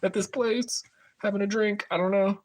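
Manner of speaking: easygoing high voice, just hanging out; high breezy self-quote. Retold or imitated. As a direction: toss it off lightly, way up high. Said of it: "And I'm like".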